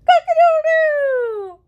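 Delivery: very high, loud drawn-out crow; rooster crow. Acted. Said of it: "says rooster".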